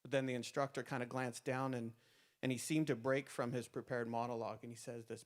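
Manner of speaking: ordinary delivery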